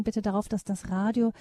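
usual speaking voice

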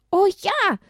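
high, loud eager oh ja; eager child. Acted. Esther thrilled to feed the donkeys, high and eager. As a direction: jump at the idea. Child excited about feeding.

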